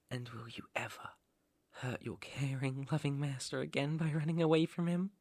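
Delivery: very soft, near-whispered question; quiet warning. Acted. Master asks whether captive will run away, hushed warning. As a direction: drop to a hushed, controlling murmur.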